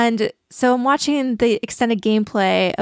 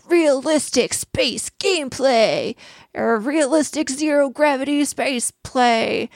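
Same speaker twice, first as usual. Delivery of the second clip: slow, dramatic trailer narrator voice; booming game trailer read; video game announcer voice; 3 semitones higher, slower than usual. Acted. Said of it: "Doing the, doing the video game announcer voice".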